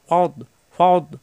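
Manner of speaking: ফদ slowly, tongue's back edge against the upper molars; tongue-edge heavy D. Shown as a demonstration. Teacher quizzing learners on articulation point, tongue-edge D in ফদ. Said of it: "জিহ্বার গোড়ার কিনারা উপরের মাটি জাতের গোড়ায়".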